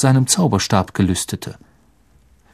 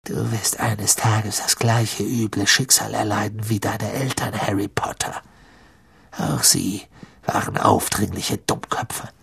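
low, quiet menace; zornige Drohung; 5 semitones lower, 8 dB quieter than usual. A narrator's reading. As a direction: threaten coldly under your breath, lower and softer. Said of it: "sah zornig von dem zerstörten Buch". (leise, tiefe, drohende Stimme)

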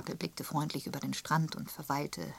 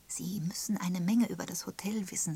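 ordinary delivery

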